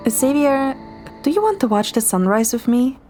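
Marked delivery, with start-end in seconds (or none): none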